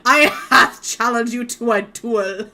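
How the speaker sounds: hammed-up old English challenger; old English impression. Offended gentleman challenges someone to a duel, hammed-up old English. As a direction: put on a mock-grand old English voice.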